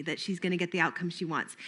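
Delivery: normal talking voice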